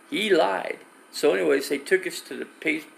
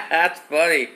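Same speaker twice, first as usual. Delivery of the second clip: amused laughter; 5 semitones higher, 8 dB louder than usual. Real, not acted. Streamer cracking up at something funny, laughing. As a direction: burst of loud laughing, drawn out.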